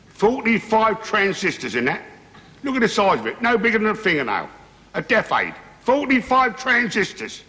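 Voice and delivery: high-pitched boasting; impressed, high-voiced bloke in a joke; proud show-off. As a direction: marvel at the gadget, pitched well up. Joke character shows off hearing aid.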